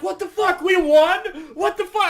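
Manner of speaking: high, loud disbelieving cries; stunned disbelief. Retold or imitated. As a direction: shout in shocked delight. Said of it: "we were like".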